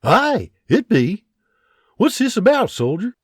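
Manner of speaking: guarded father questioning a stranger; hint of a Scottish farmer; lightly voiced Scottish farmer. William voiced in character.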